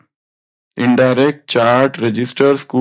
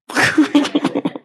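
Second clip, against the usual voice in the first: laugh; 13 semitones higher than usual. Real, not acted. Teacher amused by spelling mixup.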